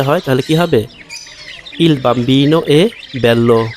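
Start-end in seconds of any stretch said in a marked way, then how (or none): 1.8-3.7 very slow, each word laid out clearly; slow teacher demo; slow model reading. Shown as a demonstration. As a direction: spell the sentence out slowly for learners. Teacher models Italian sentence.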